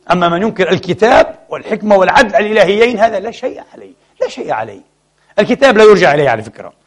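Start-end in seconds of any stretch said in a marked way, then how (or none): 3.0-4.8 mock shrug, dropping away; sarcastic drop. Preacher mocking double standards, sarcastic and falling to a murmur. Sarcastic aside about deniers.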